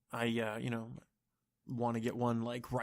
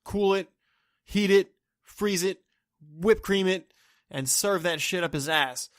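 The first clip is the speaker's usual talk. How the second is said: mock barista; 4 semitones higher, 5 dB louder than usual.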